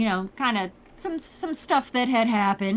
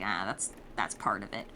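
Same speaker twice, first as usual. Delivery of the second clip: low, grave Marcelia; 13 semitones lower, 9 dB quieter than usual. Retold or imitated. Marcelia voiced in the recap.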